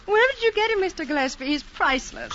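very high, amused exclamation; delighted gushing. Acted. Tourist woman to Mister Gillespie about Clive, delighted gushing. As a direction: gush over the entertaining beachcomber.

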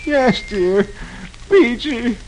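utterly beaten, halting moan; slow, tearful groan. Acted. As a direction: groan it slowly, near tears. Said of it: "slowly groans".

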